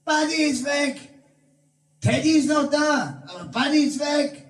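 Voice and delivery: hoch gespielter Jammerruf; verzweifelter Schrei. Retold or imitated. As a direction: ruf verzweifelt nach dem Hund. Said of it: "Hinter mir schrie Sonja". (Sonja schreit verzweifelt nach dem Hund)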